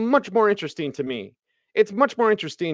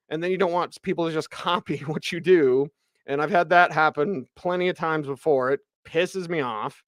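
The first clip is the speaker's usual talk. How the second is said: ordinary delivery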